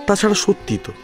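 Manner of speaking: ordinary talk, nothing marked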